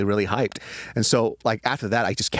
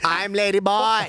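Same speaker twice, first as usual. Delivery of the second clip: mock high voice; 12 semitones higher, 3 dB louder, slower than usual. Joking voice, laughing.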